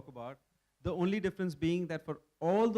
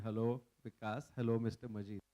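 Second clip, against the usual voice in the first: lower, subdued, awkward hellos; tongue-tied greeting; 5 semitones lower than usual. Retold or imitated. Two friends stuck without an interpreter, low awkward greeting. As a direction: act out stiff hellos with nothing more to say. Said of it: "we could not say one word beyond".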